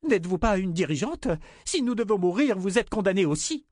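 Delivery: high-pitched accusing protest; indignant cry. A narrator's reading. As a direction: accuse her in a high, heated voice. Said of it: "cria Ornetta". (protestation indignée, voix aiguë)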